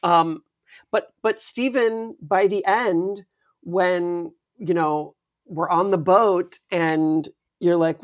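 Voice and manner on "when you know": normal talking voice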